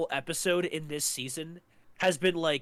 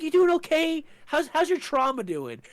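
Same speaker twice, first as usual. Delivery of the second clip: high, louder mock concern; mock caring Walt; 11 semitones higher, 5 dB louder than usual. Walt teasing Hank about trauma, high mock concern. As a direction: fake sympathy, pitched way up.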